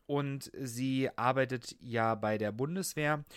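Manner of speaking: ordinary talk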